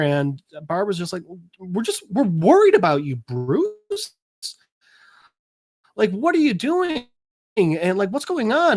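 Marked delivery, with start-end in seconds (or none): 1.6-8.8 lightly acted worry, pitched up; imitating a concerned friend's questions; worried concern. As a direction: press a friend for answers, voice rising high. Barbara voiced, worried about Bruce.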